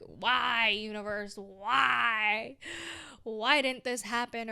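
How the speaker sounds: loud pleading cry at the universe; exasperated why. Retold or imitated. A vlogger mock-yelling at the universe in exasperation. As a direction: throw the question up at the sky in frustration.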